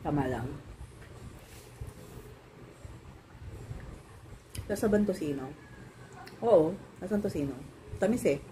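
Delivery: food in mouth; chewing between words; talking while chewing. Real, not acted. Seen 0: Woman's lips pursed and cheeks working, chewing motions throughout the stretch.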